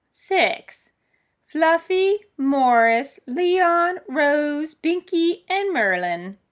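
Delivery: put-on high, loud old-lady voice; old woman listing. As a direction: call out each pet's name separately. Old woman lists her cats.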